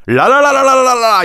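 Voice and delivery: a bright mock la-la laugh; imitated giggle. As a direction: giggle it out in la-las. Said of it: "言うて、笑うて".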